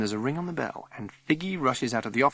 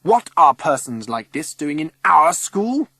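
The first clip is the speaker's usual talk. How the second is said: loud, high, outraged tirade; shouting; 6 semitones higher, 7 dB louder than usual. A narrator's reading. Governor railing at visitors in the school hall, shouting. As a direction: shout in scandalised indignation. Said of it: "Missus Summers shouts".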